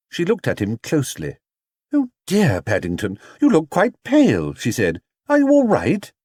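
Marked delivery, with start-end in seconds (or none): 1.9-4.5 higher, a concerned mother; worried Missus Brown. A narrator's reading. Missus Brown worried that Paddington looks pale, high and concerned. 5.3-6.1 higher, a concerned mother; worried Missus Brown. A narrator's reading. Missus Brown worried that Paddington looks pale, high and concerned.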